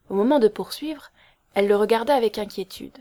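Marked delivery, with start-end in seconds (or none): none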